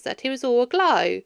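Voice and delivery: plain reading voice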